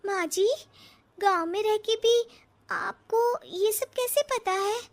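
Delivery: high and slowed in wonder; amazed question. Acted. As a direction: let the amazement stretch the words. Bride amazed at mother-in-law.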